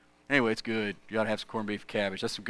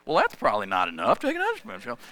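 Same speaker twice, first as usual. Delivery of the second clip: grandfather's voice; faster than usual. Retold or imitated. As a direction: goad the boy on like a mischievous grandpa. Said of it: "So I take a big spoonful".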